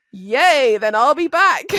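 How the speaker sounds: high, loud "yay" burst; loud, playful enthusiasm; mock-delighted cheer. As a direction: cheer as if thrilled to return. Acts out cheering at more work.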